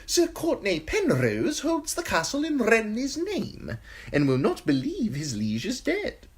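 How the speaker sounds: high, sly eunuch voice, unhurried; high giggly spymaster relishing the gruesome news; Varys the eunuch. Acted. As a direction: purr it high and insinuating, a courtier savouring gossip. Varys voice held.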